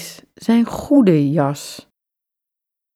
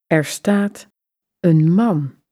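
plain reading voice

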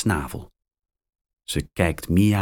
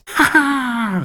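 high teasing haha; gleeful laugh. A narrator's reading. Mia teasing Max as he loses his piece, high laugh. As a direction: laugh at Max's bad luck. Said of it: "roept ze".

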